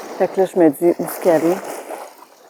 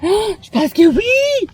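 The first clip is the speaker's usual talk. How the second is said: excited; 9 semitones higher than usual. Real, not acted. Spots the seals, thrilled.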